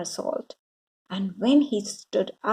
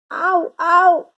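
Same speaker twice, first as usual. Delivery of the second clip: high, loud cry of pain; boy in pain at night; yelling; 8 semitones higher, 8 dB louder than usual. A narrator's reading. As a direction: yelp as the tooth sticks. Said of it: "yelled William".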